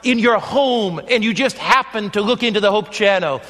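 full-voiced evangelist preaching to viewers. Evangelist preaching to viewers at home, fervent sermon voice. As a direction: plead with the viewers, rising and falling.

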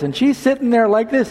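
ordinary talk

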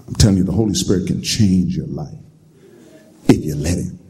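normal talking voice

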